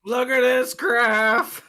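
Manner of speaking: high, loud, very slow; meme voice. Pretend. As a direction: stretch it out, mocking the meme. Repeats the meme line.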